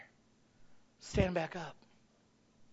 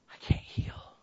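whispered; slower than usual. Real, not acted. Quiet quote of grieving people.

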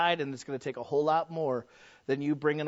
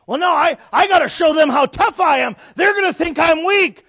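loud, high shouted protest; high-pitched blustering yell; indignant objection; 11 semitones higher, 14 dB louder than usual. Acted.

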